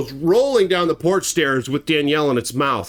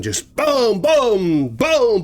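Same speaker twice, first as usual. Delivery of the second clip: punchy booms, louder each hit; imitated slamming booms; 4 semitones higher, 3 dB louder, much slower than usual. Teller imitating the bear slamming the door, punchy booms. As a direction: thump out the bear's blows.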